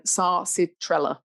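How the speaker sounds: sarcitrella said slowly, syllable by syllable; slow, careful sarcitrella. Shown as a demonstration. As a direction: spell out sarcitrella carefully by sound. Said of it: "I said that a bit quickly then".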